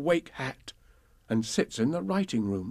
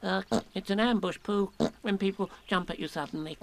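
Piglet voice; 7 semitones higher than usual. Acted. Piglet explains.

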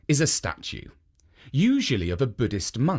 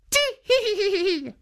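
very high tittering giggle; high old woman's tee-hee. A narrator's reading. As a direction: giggle it out high like a tickled granny. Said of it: "laughed the old woman".

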